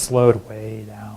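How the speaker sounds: words drawn out slowly to match their meaning; slowed way down. Acted. Speaker telling the audience how they stalled, drawn-out slow. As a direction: stretch each word out, slowing down. Draws out words describing slowing down.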